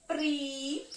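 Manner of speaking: "pri" drawn out as one syllable; isolated, stretched "pri"; syllable marking. Shown as a demonstration. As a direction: hold "pri" on its own for counting. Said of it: "eu vou marcar a sílaba".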